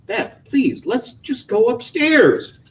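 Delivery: high, slow weary plea; pleading. A narrator's reading. Husband begging wife to come upstairs, slow and weary. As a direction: draw it out, worn down.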